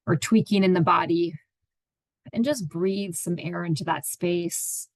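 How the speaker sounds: unhurried meditation guide, long pauses between phrases; guided meditation. Acted. Therapist guiding listeners into their own space, meditative and unhurried. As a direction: ease listeners into stillness, leaving space after each phrase.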